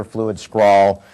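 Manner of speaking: usual speaking voice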